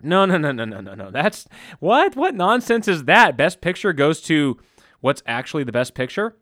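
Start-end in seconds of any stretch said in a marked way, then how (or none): 0.0-3.4 scoffing rejection. Real, not acted. Scoffing at Oscar politics.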